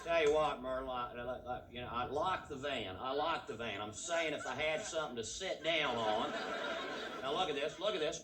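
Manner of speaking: country husband talking to Myrtle; drawling Texan van driver. Pretend. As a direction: play the cramped driver griping to his wife. Yokel driver continues.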